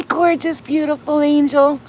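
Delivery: high cooing pet voice; sweet high pet talk. Real, not acted. Cooing to cats.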